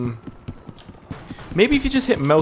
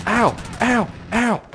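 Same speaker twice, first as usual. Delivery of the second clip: short high "ow" repeated; pained yelps; 10 semitones higher, slower than usual. Real, not acted. Gamer getting shot repeatedly, high yelps of pain. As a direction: yelp with each hit.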